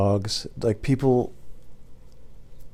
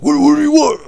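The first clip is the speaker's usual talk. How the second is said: very high, loud mock boss voice; yelling boss; 8 dB louder than usual. Boss questioning his delivery driver, imitated as a high yell. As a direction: squawk it like a hammed-up boss. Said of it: "my boss was like".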